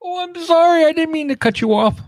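normal talking voice

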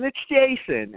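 high, eager blurt of the obvious answer; a mock know-it-all audience; confident guess. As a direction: chirp it like everyone knows. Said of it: "are sitting there thinking".

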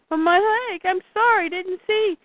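high-pitched hurt pleading; pained pleading. Retold or imitated. Retelling Lois with her injured leg, pained pleading.

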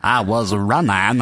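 slow, stretched mock Southern accent; Forrest Gump. Host echoes the movie quote, mock Southern drawl accent. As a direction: draw out RUNNING in a Southern accent. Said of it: "Forrest Gump".

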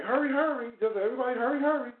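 high, half-hearted hurry-hurry voice; mock urgent hurry. Airport crowd hurrying off after a bomb, mock urgent. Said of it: "a hurry, hurry type of run".

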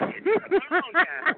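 high, rapid cackle; laughing. Real, not acted. Egging on a rival caller, high cackle. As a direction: let out a string of high giggles.

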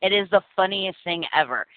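normal talking voice

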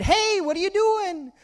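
high yell thrown back as an echo; high echoed holler. Retold or imitated. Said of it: "And the voice said".

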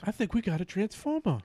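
lightly voiced movie star; Mark Wahlberg impression. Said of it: "Is that your Mark Wahlberg?".

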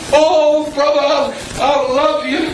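high, syrupy mock affection with a laugh; hammed-up false love to a brother; fake sweetness. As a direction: overplay a forced friendly greeting.

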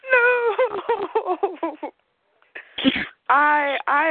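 tearful, slow apology; crying. Real, not acted. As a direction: voice breaking while crying. Sobbing while upset.

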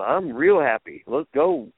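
a jolly, upbeat boss; pleased Dusty. Retold or imitated. Dusty after the segment, jolly and upbeat.

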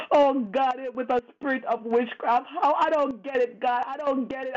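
ordinary talk

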